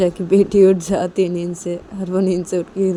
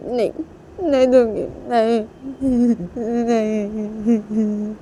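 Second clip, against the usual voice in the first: higher, slow, drawn-out wail; tearful refusal; 5 semitones higher than usual. Acted. Nisha refuses to hand over her baby, wailing tearfully. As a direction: cry through a slow, stubborn refusal.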